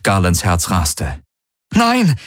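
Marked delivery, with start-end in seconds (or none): 1.7-2.2 high, desperate shout of refusal; desperate cry. A narrator's reading. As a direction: cry out sharply, pitch shooting up. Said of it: "rief er". (verzweifelter Aufschrei)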